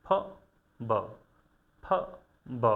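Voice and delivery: ফ and ব said alone with lips pressed together; isolated sounds. Shown as a demonstration. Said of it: "এই যে উচ্চারণটা".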